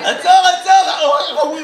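high, loud, long-drawn yell; mock chase shout. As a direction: holler after a fleeing driver, stretching the words. Acted car chase by hecklers. (צעקה גבוהה ומתמשכת)

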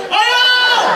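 very high, drawn-out projected cry; so it's heard. Retold or imitated. Husband pushed to say it audibly, high projected shout. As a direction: belt it out high for the crowd. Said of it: "אז תגיד לה שנשמע".

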